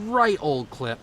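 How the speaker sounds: an accidental hint of a British accent on the phrase; slipping into British. Real, not acted. Caster slipping into British describing the build, a light accent. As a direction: let a light British lilt creep in unplanned. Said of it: "Oh, now I'm British".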